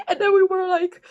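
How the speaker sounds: ordinary talk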